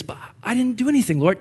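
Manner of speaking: high, fast, innocent protest; whiny protest. Pretend. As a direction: protest your innocence like a child. Adam protests to God.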